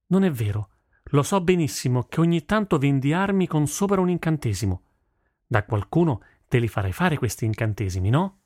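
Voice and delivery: high-pitched, contradicting her father; insistent daughter. Acted. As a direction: argue back, refusing to give up. Daughter argues back. (figlia che insiste)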